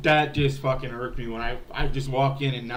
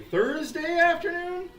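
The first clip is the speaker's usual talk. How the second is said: squeaky, much higher, slow question; suddenly unsure of the day, voice jumps way up; surprised question; 13 semitones higher, slower than usual. Real, not acted. Vlogger asks viewers what day it is, startled high voice.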